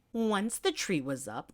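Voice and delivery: plain reading voice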